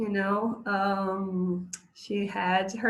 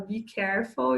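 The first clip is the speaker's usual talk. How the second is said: ordinary talk, nothing marked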